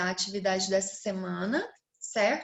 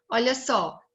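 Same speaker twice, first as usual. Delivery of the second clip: ordinary talk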